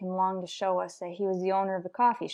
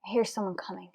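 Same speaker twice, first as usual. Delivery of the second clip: softer, held-down voice so the suspect won't hear; hushed; 7 dB quieter, faster than usual. Acted. Detective hiding in a doorway warns his partner, hushed.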